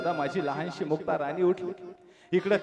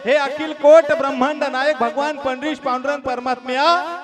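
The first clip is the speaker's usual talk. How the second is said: high, loud anguished plea; desperate prayer, slowing at the end; crying out to God; 7 semitones higher, 6 dB louder than usual. Acted. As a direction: call to God in despair. Dnyandada cries out to God.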